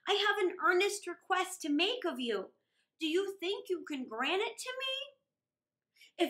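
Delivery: a lightly voiced stately dragon lord; Dragon King. Dragon King speaks to Hidesato.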